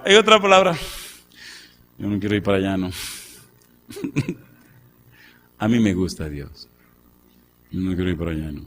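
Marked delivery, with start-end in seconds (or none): 2.0-2.9 P=A; sulky Moses. Acted. Moses reluctant. 7.7-8.6 P=A; sulky Moses. Acted. Moses reluctant, repeated.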